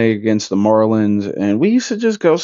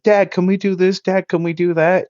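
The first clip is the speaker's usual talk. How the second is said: put-on higher-pitched kid begging Dad; pestering kids; 7 semitones higher than usual. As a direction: imitate children pestering their father. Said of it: "He didn't want us just holding on to his coattail".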